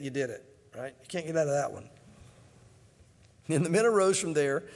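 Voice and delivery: ordinary talk, nothing marked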